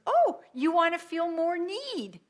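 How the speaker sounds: high, mock-innocent cosmic voice; voicing the universe. The universe answering someone who feels need, high and mock-innocent. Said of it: "the universe goes".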